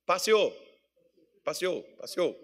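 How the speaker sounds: Passeou said as pa-si-ô, the e turned to i and the final l dropped; northern Minas Gerais pronunciation of Passeou; northern Minas accent. Shown as a demonstration. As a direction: drawl Passeou the northern Minas way, three times. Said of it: "Você sabe o que é que fala lá".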